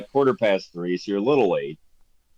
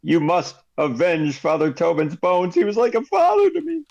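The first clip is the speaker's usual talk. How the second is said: a big barbarian near tears over his mentor; pleading barbarian; 7 semitones higher than usual. Acted. Barbarian begs Ziggy to avenge Tobin, pitch rising to tears. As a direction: voice climbing higher into grief.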